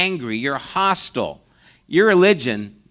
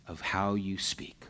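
whisper; 8 semitones lower, 14 dB quieter than usual. Real, not acted. Sermon point dropped to whisper.

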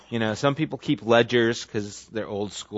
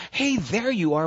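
bright, higher hello as you walk in; cheerful greeting; 8 semitones higher than usual. Retold or imitated. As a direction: greet them like you're thrilled to see them. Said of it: "walk in the room and go".

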